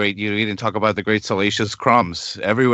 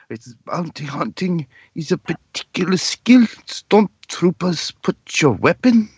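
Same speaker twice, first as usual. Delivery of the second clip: put-on slow, higher German-accented drone; Werner Herzog impression; 7 semitones higher than usual. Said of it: "great Werner Herzog dialogue".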